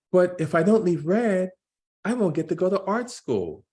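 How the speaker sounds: put-on young Jordan voice. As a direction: play the nervous twelve-year-old son. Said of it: "I'm gonna try to do voices to distinguish".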